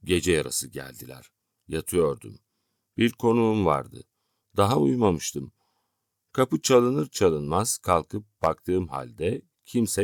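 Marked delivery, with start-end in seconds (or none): none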